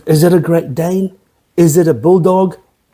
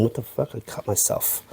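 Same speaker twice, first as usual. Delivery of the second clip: a sharp, hushed wince of real pain; muttering under his breath after a sudden cut; 4 semitones lower, 14 dB quieter than usual. Real, not acted. Host cutting himself while filming, hushed pained mutter.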